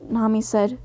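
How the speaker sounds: ordinary delivery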